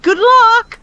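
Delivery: high, loud mock-cheerful call; cheery send-off. Stranger wishing the gamer well, exaggeratedly cheery. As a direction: imitate an over-eager well-wisher.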